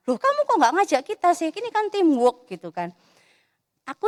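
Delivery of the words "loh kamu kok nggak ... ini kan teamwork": higher, louder indignant complaint from a fifth grader; angry kid. Retold or imitated. Classmate scolding the hardworking kid, louder and higher indignant. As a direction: huff like a classmate blaming others first. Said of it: "dia marah-marah".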